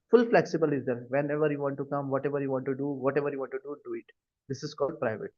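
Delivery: ordinary talk, nothing marked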